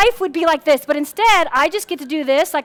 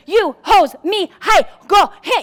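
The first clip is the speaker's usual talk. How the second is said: curt barked orders; 2 semitones higher than usual. Retold or imitated. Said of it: "No, it's".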